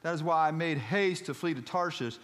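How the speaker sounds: high, loud Southern preacher reading scripture; Southern-accented pulpit plea. Acted. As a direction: proclaim the prayer with a Southern lilt. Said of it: "I don't know why I'm speaking Southern".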